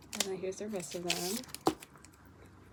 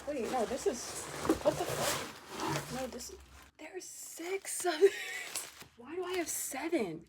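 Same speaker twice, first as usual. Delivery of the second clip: high slow confused protest; higher bewildered recount; baffled disbelief; 8 semitones higher, slower than usual. Real, not acted. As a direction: count them in growing disbelief. Confused over duplicate albums.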